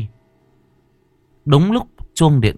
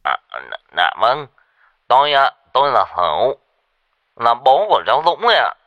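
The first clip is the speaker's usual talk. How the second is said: higher, hurried, faltering answer; nervous stammer; 3 semitones higher, 3 dB louder than usual. Acted. Old father confirms who he is to the caller, stammering nervously. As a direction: stumble into an anxious, eager reply. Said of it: "hồi lắp bắp".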